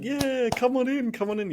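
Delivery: mock the overeager greeters; cheery greeters. Seminar crowd welcoming newcomers, high and gushing. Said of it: "They were like".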